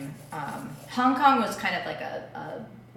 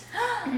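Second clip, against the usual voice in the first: excited gasp. Real, not acted. Excited reaction to new point.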